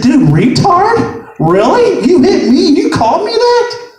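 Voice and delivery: high, incredulous indignation; acted-out offended comeback; triggered disbelief. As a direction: climb to an outraged high-pitched question. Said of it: "Now, I instantly got triggered".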